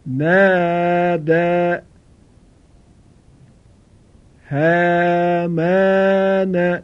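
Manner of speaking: each word said slowly with its آ held long; slow drawn-out long aa. Shown as a demonstration. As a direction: read each word slowly, stretching the آ well past a fatha. (هر کلمه را آهسته بخوان و آ را کشیده ادا کن)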